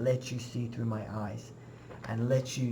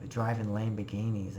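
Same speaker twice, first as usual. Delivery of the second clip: ordinary talk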